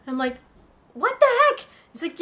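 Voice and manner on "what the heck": high-pitched, incredulous outburst; baffled surprise. Acted. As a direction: squeak it in disbelief at a stranger's order. Startled reply to a shouting stranger.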